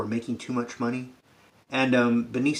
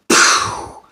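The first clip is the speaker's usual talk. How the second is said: loud blaster pow; 11 dB louder than usual. Retold or imitated. Blaster sound effect.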